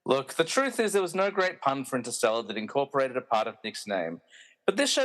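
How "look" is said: ordinary delivery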